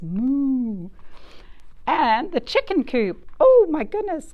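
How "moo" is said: put-on playful moo for the kids; cow moo. Shown as a demonstration. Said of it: "Cow says".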